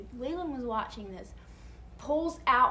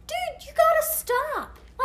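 high, loud, slow mock yell at the driver; honking at driver; 6 dB louder than usual. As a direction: call out to the reversing driver, drawn out. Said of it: "I'm like".

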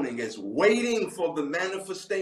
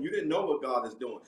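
ordinary talk, nothing marked

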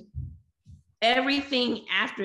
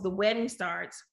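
usual speaking voice

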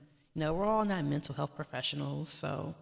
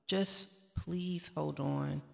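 pleading; much slower than usual. Real, not acted. Pleading with struggling viewers.

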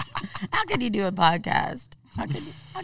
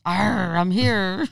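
growly pirate arr, louder; 7 dB louder, much slower than usual. Pretend. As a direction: ham up the pirate. So, but I'm here.

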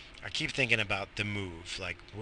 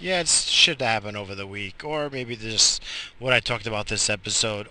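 ordinary talk, nothing marked

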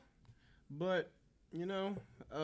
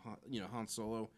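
usual speaking voice

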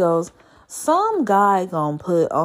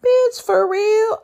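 drawn-out disbelief; 13 semitones higher, 4 dB louder, slower than usual. Retold or imitated. Retold phone call with friend.

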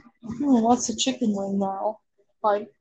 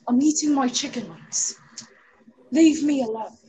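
mock British; 2 semitones higher than usual. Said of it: "I can't do a British accent".

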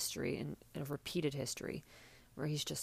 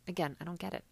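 usual speaking voice

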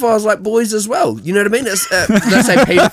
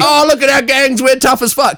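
higher, boastful mock swagger; mock tough Scots; 8 semitones higher than usual. Mocking Scottish friends, a higher, boastful swagger. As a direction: puff up like a bragging Scot. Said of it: "you guys, like".

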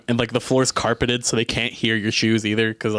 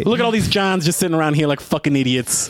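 put-on good Boston accent; 6 semitones higher than usual. Customer insulting the café to earn the Boston discount, thick Boston accent. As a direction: bark it like a Boston local demanding coffee. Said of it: "if you ordered in a Boston accent".

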